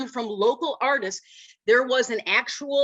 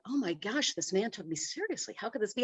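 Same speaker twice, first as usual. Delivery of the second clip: shocked inner thought; 8 dB quieter than usual. Retold or imitated.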